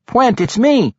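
high-pitched, urgent plea; pleading cry. A narrator's reading. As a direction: cry out to be recognised. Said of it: "Regis cried".

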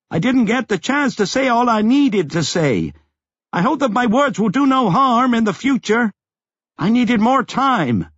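urgent last words over the wind; calling out. A narrator's reading.

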